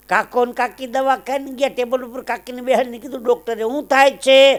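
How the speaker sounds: high-pitched comic storytelling; animated joke setup. Acted. Joke told, doctor questions old man.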